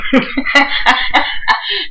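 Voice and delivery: high giggling burst; laughing. Real, not acted. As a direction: break into a giggle. Laughing at joke.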